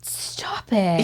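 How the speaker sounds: whispered stop it in shock; can't believe the coincidence; stunned disbelief. Real, not acted. Host finds guest went to her school, whispering in disbelief. Learns they share a high school.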